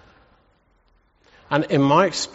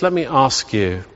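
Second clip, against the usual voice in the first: ordinary delivery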